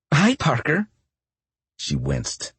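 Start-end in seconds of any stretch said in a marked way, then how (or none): 0.1-0.9 high, too-bright hello; loud and bright. Acted. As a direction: blurt the greeting too eagerly. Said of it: "She sounded loud, too bright".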